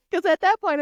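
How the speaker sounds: real laughter spilling into the line; laughing. Real, not acted. Laughing at her tiny apartment.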